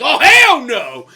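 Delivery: loud, slow "hell no" shout; outraged yell; mock outrage. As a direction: bellow it like an offended team. Players reacting to flag plant.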